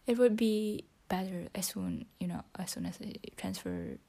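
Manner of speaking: whispering. Real, not acted. Whispering her English practice into the phone. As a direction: keep it to a whisper throughout.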